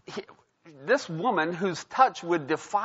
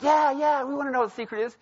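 put-on eager kids; 10 semitones higher, faster than usual. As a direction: squeal it like excited little kids. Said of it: "And they're like".

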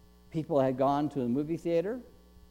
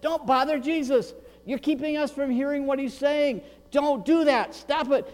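higher, raised-voice telling-off; acted rebuke, pitched up; crowd scolding; 7 semitones higher than usual. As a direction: scold the beggars as an annoyed crowd.

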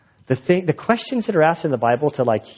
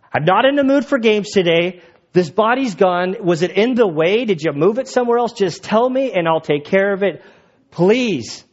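higher, fed-up pleading; exasperated; 4 semitones higher than usual. Acted. Mary demanding the body from the gardener, exasperated. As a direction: act at the end of your patience, pitched up.

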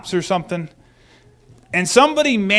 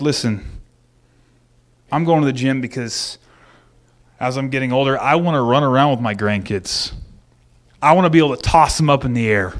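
lower, settled voice of someone who means it; earnest resolve; 6 semitones lower than usual. Retold or imitated. Preacher voicing a man explaining why he goes to the gym, lower and earnest. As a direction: speak it as a real commitment, from the gut. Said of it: "But if you're like".

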